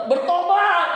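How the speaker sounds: high, very loud preacher's shout of one word; booming call to repent. Retold or imitated. Pastor shouting at a glaring church member to repent. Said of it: "kalau jemaat melotot".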